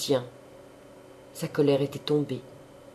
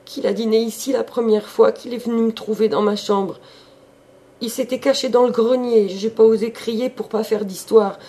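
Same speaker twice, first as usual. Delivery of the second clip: higher, louder maid pouring it out; feverish confession; 8 semitones higher, 7 dB louder than usual. A narrator's reading. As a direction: blurt it all out in a fever. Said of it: "saisie aussitôt d'une fièvre de parler".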